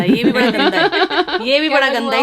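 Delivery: laughing, slow teasing scold. Real, not acted. Laughing as she scolds the absent friend. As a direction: tease him through laughter.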